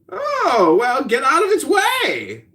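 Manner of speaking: very high theatrical exclamation; exaggerated high-pitched reply; sleeper's companion. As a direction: shout advice in a hammed-up voice. Companion answers the dreaming sleeper.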